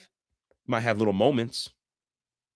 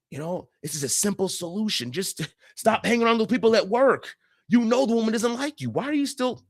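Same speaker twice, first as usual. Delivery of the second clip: higher, impatient know-it-all tone; dismissive inner voice; 8 semitones higher than usual. Retold or imitated. Listener silently lecturing his upset partner, higher impatient dismissal. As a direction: brush her problem off as obvious. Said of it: "you could even think in your mind".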